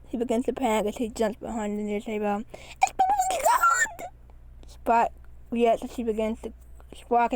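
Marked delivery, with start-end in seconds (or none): 2.8-4.1 high, slow strained cry; panicked scream. A narrator's reading. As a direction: shriek the warning.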